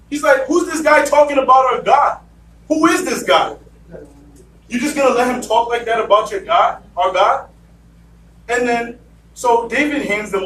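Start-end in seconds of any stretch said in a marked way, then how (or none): 0.5-7.5 outraged shout. Retold or imitated. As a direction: demand who dares mock our God. David outraged at Goliath's taunt.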